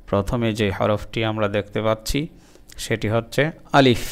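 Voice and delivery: ordinary talk, nothing marked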